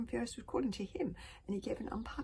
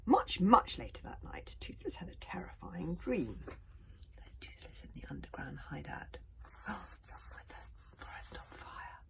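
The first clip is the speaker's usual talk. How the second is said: whispered story reading; 2 semitones lower than usual. A narrator's reading. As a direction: read it in a hushed whisper to sleepy children.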